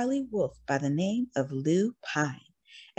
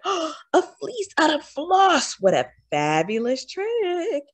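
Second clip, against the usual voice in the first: delighted wolf; 9 semitones higher than usual. A narrator's reading. Wolf amazed at the fair, high and thrilled. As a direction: gasp with wonder at the idea.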